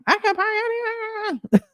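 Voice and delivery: high squealing imitation of a yelling girl; mock screaming girl. Mocking the neighbor girl's high screech. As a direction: shriek it like a bratty teen. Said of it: "started screaming and yelling".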